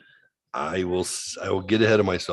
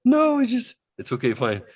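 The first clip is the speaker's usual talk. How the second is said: much higher flustered voice; imitating flustered girlfriend; 11 semitones higher than usual. Retelling his girlfriend backpedaling, in a high flustered voice. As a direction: play her scrambling to take it back. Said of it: "She's like".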